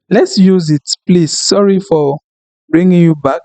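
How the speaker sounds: ordinary talk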